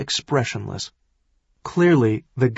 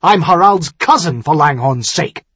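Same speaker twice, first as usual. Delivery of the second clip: loud, raised exasperated protest; hot indignation; 5 semitones higher, 7 dB louder than usual. Acted. Accused nobleman invokes his royal kinship, hot indignation. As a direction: insist hotly, pitch and volume up. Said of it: "Tyrion said hotly".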